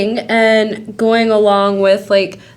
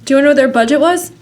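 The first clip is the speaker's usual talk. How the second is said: ordinary talk, nothing marked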